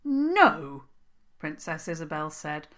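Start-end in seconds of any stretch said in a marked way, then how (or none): 0.0-0.8 flat-out stubborn refusal. A narrator's reading. Princess refusing the troll king, stubborn. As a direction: dig in your heels on the word No. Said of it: "just as stubbornly as a troll".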